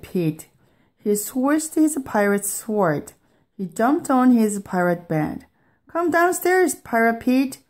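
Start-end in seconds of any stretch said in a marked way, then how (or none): 5.9-7.6 mom's high-pitched call from downstairs; lightly acted motherly call. A narrator's reading. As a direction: call up to a child in a raised pitch. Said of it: "called his mom".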